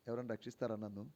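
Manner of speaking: soft, low mock plea; mild quiet asking. Sinking man politely asking to be saved, softly. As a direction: ask meekly for help, hushed and low. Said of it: "అని అడుగుతాడా?".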